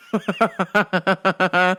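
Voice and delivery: long staccato chuckle; laugh. Real, not acted. Host laughing at their own letdown, staccato laugh. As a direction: rattle out a steady laugh.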